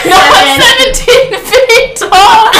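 very high, slow laughing sarcasm. Retold or imitated. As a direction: drag it out mockingly while laughing. Retold sarcastic reply.

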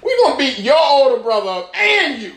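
high-pitched mock threat, half laughing. Bullies threatening both brothers, high mock threat.

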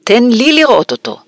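high, insistent plea; demanding. A narrator's reading. Son demanding to see the letter, high insistent voice. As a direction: demand a look like a spoilt child. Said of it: "דרש דדלי".

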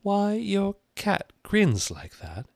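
slow, high, careful question; mildly timid girl, pitched well up; a little timid. A narrator's reading. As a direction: ask tentatively in a small high voice. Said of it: "said Alice a little timidly".

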